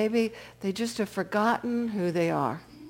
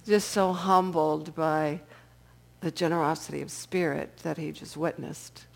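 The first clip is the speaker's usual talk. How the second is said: ordinary talk, nothing marked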